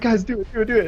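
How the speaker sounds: high, chanting urge; excited egging on. Retold or imitated. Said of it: "I was like".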